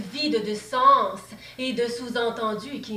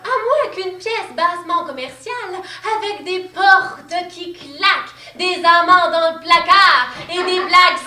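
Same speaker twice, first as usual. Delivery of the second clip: higher and louder, swelling into a sing-song rant; mounting mocking tirade; 5 semitones higher, 9 dB louder than usual. Playwright mocking the critic's taste for farce, swelling sing-song rant. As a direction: pile the farce clichés on in rising scorn.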